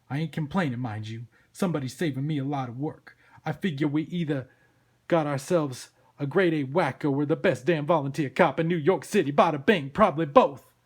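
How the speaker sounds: loud, wry police boss; blustering cop Sam. Acted. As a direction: hold forth loudly like a street-wise cop.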